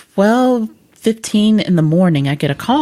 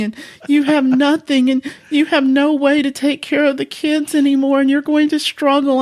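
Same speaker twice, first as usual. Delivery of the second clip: retold despairing apology, pitched higher; imitating tearful apology; 6 semitones higher than usual. Wife on a midnight call, apologising for dying, mock tearful. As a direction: play her distraught sorry-I-died plea, half-mocking. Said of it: "She's like".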